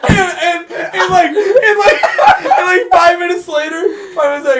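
high, loud, helpless laughter through the words; cracking up. Real, not acted. Telling friends the shrimp and grits story, cracking up.

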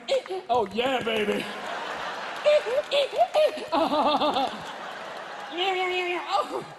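very high, loud ecstatic grunts; mock aroused moaning. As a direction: ham up the pleasure. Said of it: "men will be going".

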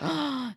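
a quick mock gasp of shock. Viewers gasping at the train scene, mock gasp. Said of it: "they were like".

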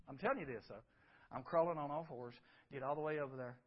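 low, soft, creeping narration; hushed suspense. Retold or imitated. Preacher telling congregation how he crawled toward the figure, hushed. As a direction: whisper it as you sneak up.

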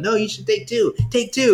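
high, mocking imitation of the mechanics; high mock nagging. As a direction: parrot them in a high nagging voice. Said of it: "they're going".

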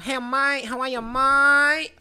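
loud, slow mock Chinese Aussie accent "mate"; stretched Chinese Aussie accent, loud and slow.

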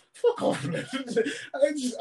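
usual speaking voice

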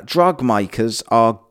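ordinary talk, nothing marked

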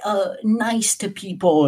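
hammed-up feel-good lesson; cheesy moral. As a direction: deliver it like a corny life lesson. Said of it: "the most cheesy shit ever".

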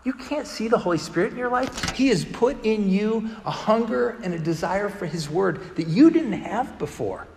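retold earnest, measured insistence; pointed insistence. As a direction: act out a friend driving the point home. Retold friend drives his point home.

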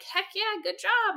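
high bright mock cheer. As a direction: cheer them on, playfully over the top. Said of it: "And I'm like".

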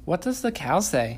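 high, sing-song parent voice. Real, not acted. Talking to toddler daughter.

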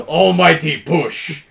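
mock-epic slow call; drawn-out move call. Friend acts out an ability's name, drawn-out call. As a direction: call out the attack, stretched out.

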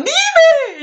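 high, loud pleading "dime"; insistent demand. Retold or imitated. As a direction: press her to spill it. Amigas insistiendo. (exigencia aguda y emocionada)

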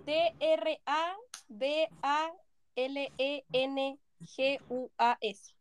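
letters spelled out slowly and softly; slow spelling. Shown as a demonstration. Spelling trabalenguas for friends, slow and soft.

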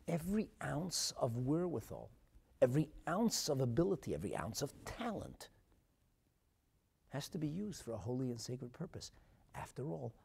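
normal talking voice

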